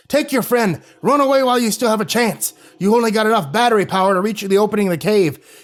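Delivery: put-on high furious old man shout; angry shouting. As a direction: yell at them to run. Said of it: "Creepy Voice shouted angrily".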